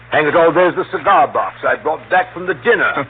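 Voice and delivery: loud, eager triumph; proud of finding proof; excited and pleased; delighted discovery. Acted. Uncle remembers his alibi, delighted.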